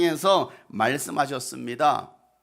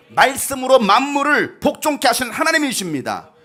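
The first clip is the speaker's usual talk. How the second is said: usual speaking voice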